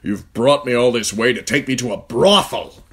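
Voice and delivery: Ned furious at Littlefinger for the brothel. Acted. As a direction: hurl the accusation in a fury. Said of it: "dismounted in a fury".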